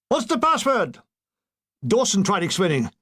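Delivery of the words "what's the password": very high shouted halt; shouted challenge. Acted. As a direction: bark like a sentry. Sentry demanding.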